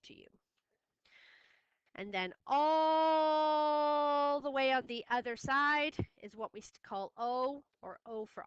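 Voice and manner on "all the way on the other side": "all" held very long, high and loud; drawn-out ALL. Shown as a demonstration. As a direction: stretch "all" out to show how far across it is. Teacher stretches "all" to show distance.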